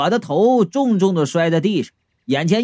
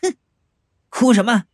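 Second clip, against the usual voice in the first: cold sneer; 5 semitones higher than usual. A narrator's reading. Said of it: "冷笑道".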